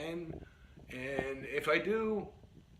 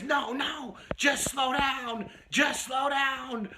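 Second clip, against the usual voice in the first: high, loud, stretched-out shouting; frantic yelling; 13 semitones higher, 7 dB louder, much slower than usual. Retold or imitated. Passenger to driver on being asked if she's okay, frantic yelling. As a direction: imitate a panicked passenger screaming at the driver.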